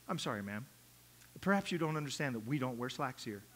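low, soft prissy scold; prim church lady. Retold or imitated. Church lady quietly scolds a woman in slacks, prim. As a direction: look down your nose at the visitor.

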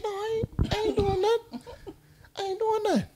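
high, pleading "I ain't doing nothing", voice pushed way up; mock innocent denial. Storyteller playing innocent to the guys in the trap, high mock denial. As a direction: play dumb, high and wide-eyed with a laugh behind it.

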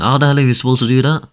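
usual speaking voice

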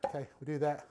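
ordinary talk

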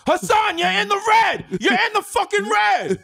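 high, loud outraged yelling; angry shouting. Pretend. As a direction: bellow at the DJ. Impersonating Taffer yelling.

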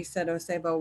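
plain reading voice

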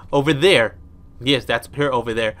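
ordinary talk, nothing marked

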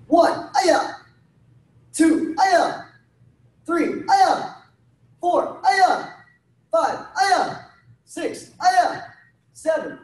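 high, punchy called count with short yells; drill count with kiai. Real, not acted. Instructor drilling high block punches, high punchy count and yell. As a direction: call each number, then a sharp high kiai.

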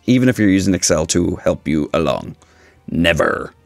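usual speaking voice

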